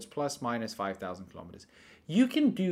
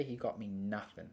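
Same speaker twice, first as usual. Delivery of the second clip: ordinary delivery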